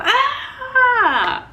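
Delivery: high, loud newborn wail; mimicking her son's first cry; imitated newborn cry. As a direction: let out a baby's first cry. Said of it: "I heard Ezra go".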